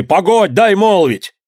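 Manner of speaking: high, urgent call; shouted interruption. A narrator's reading. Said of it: "на него закричали".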